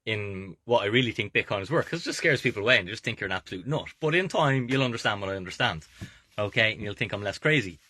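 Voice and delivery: usual speaking voice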